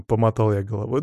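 ordinary delivery, nothing marked

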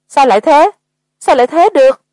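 high, loud, distraught disbelief; tearful outburst. A narrator's reading. Man in the group breaks down at the coffin task, tearful. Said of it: "Có người lập tức bật khóc nức nở".

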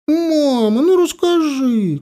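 put-on children pestering mother for the story, high whine; whining kids. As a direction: nag like bored children. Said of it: "конючили дети".

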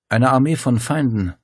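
ordinary delivery, nothing marked

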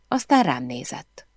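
ordinary delivery

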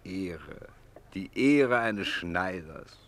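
drawn-out derisive jeer; scornful scoff. Acted. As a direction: sneer the words out slowly. Scoffs at a tailor's honour.

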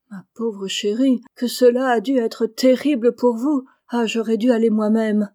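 remorseful outburst, moderately played; full of remorse. A narrator's reading. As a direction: exclaim with sympathy and self-reproach. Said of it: "dit Jo pleine de remords".

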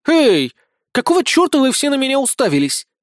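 high, loud challenge; defensive outburst. A narrator's reading. Small blonde sees everyone staring at her, defensive. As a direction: snap back at accusing stares.

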